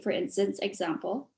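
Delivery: ordinary delivery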